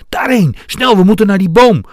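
high, loud urgent yell; shout. A narrator's reading. Dwarf directs the others to the tree, shouting. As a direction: yell directions to your friends over danger. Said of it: "schreeuwde hij tegen de anderen".

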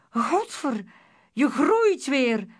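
loud, high alarmed shout. A narrator's reading. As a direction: yell the curse in alarm. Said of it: "schreeuwde Wankel".

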